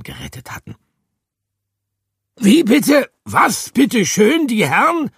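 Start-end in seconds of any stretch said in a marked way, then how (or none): 2.4-5.1 high, loud, slow affronted aristocrat; indignant noble hamster. A narrator's reading. Hamster protesting being left out, indignant and high. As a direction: take offence like a gentleman.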